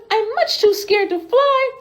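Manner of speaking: high, tiny frightened bird voice; scared little bird. Acted. Baby bird hiding from Betty, in a high timid voice.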